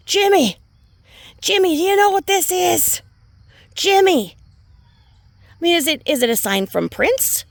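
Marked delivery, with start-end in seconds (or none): 0.1-4.4 high, loud panicked wife calling Jimmy; mocking a panicked mom on a live video, high and loud; frantic mommy voice. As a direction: mimic a frantic mom wailing for her husband. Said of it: "Mommy ramblings".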